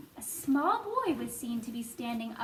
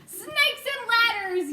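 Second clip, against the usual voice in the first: high, loud, slow yell; 8 semitones higher, 5 dB louder, slower than usual. A narrator's reading. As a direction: bellow it in alarm. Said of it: "yelled the head of the fire department".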